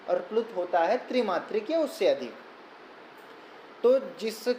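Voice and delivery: usual speaking voice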